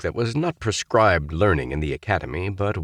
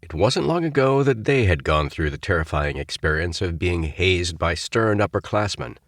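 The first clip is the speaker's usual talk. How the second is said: ordinary delivery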